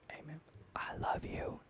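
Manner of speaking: soft, slow, whispered thanks. Real, not acted. Whispering to the viewers who watched to the end. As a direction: breathe it close to the mic. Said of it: "whispered sign-off to viewers".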